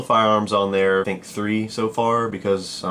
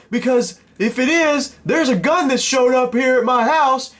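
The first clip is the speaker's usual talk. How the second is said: irate caller. Retold or imitated. Furious stranger phoning about a gun shipped to his house, high-pitched angry mimicry. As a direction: put on a much higher voice and rant nonstop, outraged.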